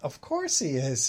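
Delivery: normal talking voice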